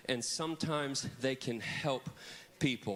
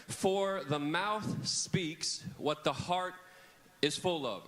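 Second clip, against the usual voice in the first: slow recitation; much slower than usual. Shown as a demonstration. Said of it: "what I want you to do is look up there".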